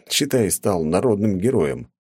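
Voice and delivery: ordinary talk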